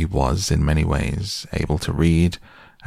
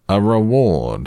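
REWARD raised high and louder; 4 semitones higher, 5 dB louder than usual. A narrator's reading. Owl insisting to Pooh on a reward, very loudly. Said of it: "said the Owl very loudly".

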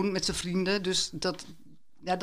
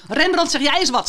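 higher, louder shouted call; shouting; 8 semitones higher, 5 dB louder than usual. Acted. Dylan calling out to Rembrandt in the museum, louder shout. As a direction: yell it across the gallery at the painting. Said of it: "schreeuwde Dylan".

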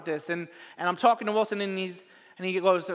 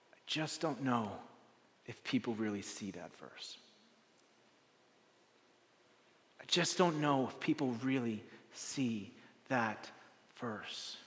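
much softer and lower, slow musing; quiet, to himself; 8 semitones lower, 11 dB quieter, slower than usual. Retold or imitated. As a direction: murmur it almost to yourself. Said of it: "he gets quiet".

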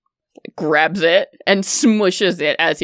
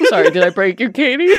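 through laughter; laughing; 3 semitones higher, 4 dB louder, much faster than usual. Real, not acted. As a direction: ask while laughing.

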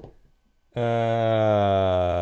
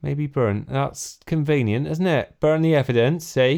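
put-on West Country accent; 3 semitones higher than usual. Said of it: "my West Country accent".

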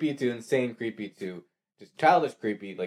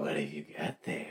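low, soft creepy clown voice; lightly voiced sinister clown; 9 semitones lower, 13 dB quieter than usual. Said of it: "I was trying to do It".

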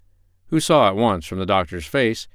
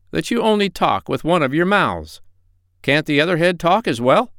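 high-pitched duck remark; inquisitive duck; 8 semitones higher, 3 dB louder than usual. A narrator's reading. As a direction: pipe up as a curious duck. Voices the duck.

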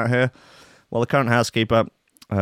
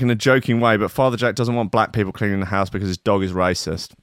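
ordinary talk

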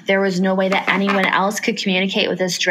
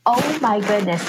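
ordinary talk, nothing marked

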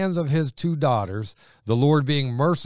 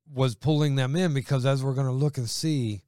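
ordinary delivery

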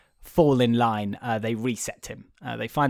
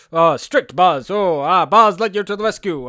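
heroic Buzz voice; 6 semitones higher, 9 dB louder than usual. Retold or imitated. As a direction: ham up a proud space ranger. Retold Buzz in police mode.

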